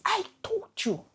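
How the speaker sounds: high-pitched mock nagging, told-you-so; imitating a scolding voice. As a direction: play the voice in her head scolding her. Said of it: "I might sit and ask".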